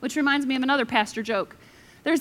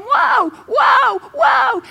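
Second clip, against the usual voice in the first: much higher, loud shouted whoa; panicked yell; 11 semitones higher, 8 dB louder, much slower than usual. Retold or imitated. Rider shouting at the bolting horse, loud and panicked. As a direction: scream at a runaway horse. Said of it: "He's yelling".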